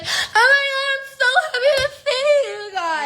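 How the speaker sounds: high gushing mock greeting; fake happy gush. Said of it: "and she's like".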